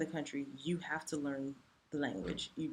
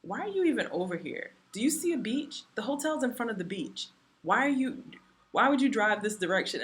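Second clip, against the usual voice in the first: higher, louder exasperated scolding; mock scolding; 6 semitones higher, 5 dB louder than usual. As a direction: scold her for driving the wrong way. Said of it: "He's like".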